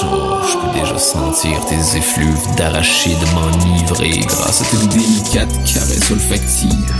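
low, sultry voice to a sandwich. Acted. Sensual talk to sandwich.

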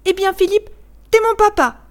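put-on boy telling Philippe he is his papa; consoled child. A narrator's reading. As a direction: reach out arms, comforted. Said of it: "tout consolé".

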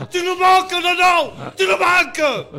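mock-emotional southern shout into the mic; southern announcer accent. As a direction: cry out Tu nous manques like a tearful southern PA announcer. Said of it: "je l'ai fait avec l'accent du Sud".

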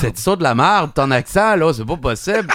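loud, hammed-up Québécois accent. Host mimics a Québécois critic of his accent, loud mock Québécois. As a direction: exaggerate a mock Québécois outburst. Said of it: "de manière pourrie, hein, l'accent".

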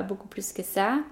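ordinary delivery

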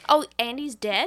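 normal talking voice

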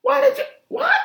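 rising to a shocked, high squeak; incredulous. Retold or imitated. To a friend over an award, shocked and squeaky. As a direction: disbelief climbing into a yelp. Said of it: "And I'm like".

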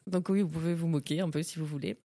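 ordinary talk, nothing marked

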